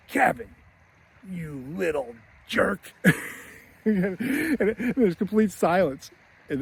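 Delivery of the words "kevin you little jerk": slow, mock-mean uncle; Uncle Frank. Uncle soaked in milk insults Kevin, slow mock contempt. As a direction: imitate a mean old man, spacing out each word. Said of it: "mean old Uncle Frank".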